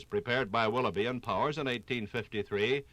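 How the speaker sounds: normal talking voice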